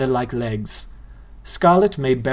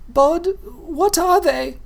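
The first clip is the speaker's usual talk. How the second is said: put-on much higher, very slow, timid girl; much slower than usual. A narrator's reading. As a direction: ask it scared and small. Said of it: "a timid".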